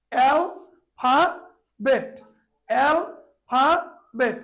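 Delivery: Alphabet said very slowly, split into three separate beats; alphabet drawn out as al-pha-bet, each syllable apart. Shown as a demonstration. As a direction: stretch alphabet out, one beat per syllable. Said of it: "এই তিনবারে".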